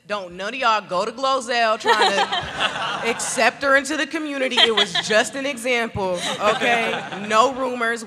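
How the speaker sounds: laughing, loud playful warning. Real, not acted. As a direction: laugh while scolding the audience.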